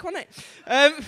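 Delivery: ordinary delivery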